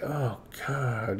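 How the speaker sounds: low, soft groan of dismay; mild mock dread under the breath; retold dismay. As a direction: drop it low and quiet, dismayed. Retelling dismay at her crying.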